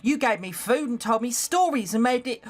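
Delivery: put-on high-pitched working-class London maid accent; Becky's London accent. Maid helping a young lady dress, high London accent. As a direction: play the servant girl in a London accent. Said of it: "I have to fucking do this accent".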